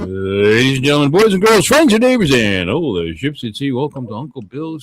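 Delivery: booming ringmaster intro; grand entrance announcement. Acted. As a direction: announce it to the whole arena. Host opens the show.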